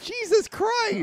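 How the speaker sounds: very high, loud, stretched-out cry of disbelief; shocked exclamation. Real, not acted. Co-host hearing he kicks the beehive, loud shock. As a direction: exclaim in real alarm at the stupidity.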